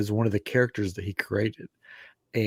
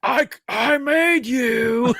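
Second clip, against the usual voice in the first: higher, slow, laughing proud claim; mock proud creator; 12 semitones higher, 3 dB louder, much slower than usual. Teller mimics brother telling cosplayer, slow laughing boast. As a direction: announce it slowly through a laugh. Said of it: "He goes".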